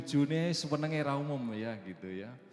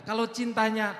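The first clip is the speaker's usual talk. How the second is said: high, loud preacher's voice, slowing on the key line; preaching at full force; 11 semitones higher, 9 dB louder than usual. Acted. Pastor preaching to the congregation about fearing God, high and loud. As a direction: drive the point home from the pulpit.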